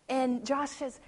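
ordinary talk, nothing marked

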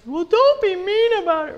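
put-on high, loud indignant child's whine; whiny kid. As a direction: sound like a hurt little kid talking back. Kid answering the snapping parent.

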